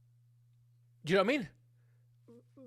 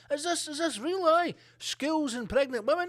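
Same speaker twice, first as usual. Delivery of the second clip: Biden reading; 10 semitones higher than usual. Retold or imitated. Biden reads his speech in a higher, baffled voice. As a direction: stumble over the words as if just seeing them. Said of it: "And he's like".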